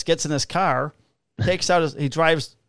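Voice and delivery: normal talking voice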